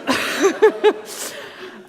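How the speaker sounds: short airy laugh, rising high; quick unvoiced giggle up high; breathy laugh. Real, not acted. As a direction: laugh it off, a little embarrassed. Laughs at own anger.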